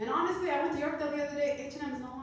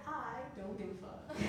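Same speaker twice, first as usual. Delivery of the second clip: normal talking voice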